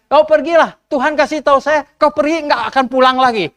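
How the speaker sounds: prophet's doom word. Retold or imitated. Prophet Mika warns Ahab he won't return. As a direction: pronounce the doom plainly.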